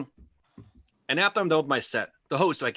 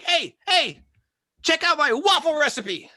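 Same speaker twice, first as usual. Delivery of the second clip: high, loud goofy voice of a chatty audience member; annoying heckler; 12 semitones higher, 7 dB louder, slower than usual. Pretend. Comic imitates rude comedy club talker in high loud voice. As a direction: ham up a rude guy in the crowd. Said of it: "someone could just be like".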